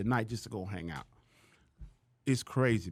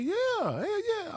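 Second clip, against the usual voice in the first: high, slow, stretched-out eager yes; much slower than usual. Retold or imitated. Accepting the promoter's offer, high and drawn out. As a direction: jump at the offer. Accepting the gig, nervous excitement.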